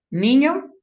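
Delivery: usual speaking voice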